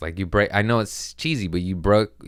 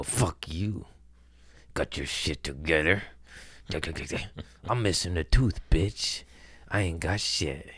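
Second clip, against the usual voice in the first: bitter mock rant; 5 dB quieter than usual. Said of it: "I go".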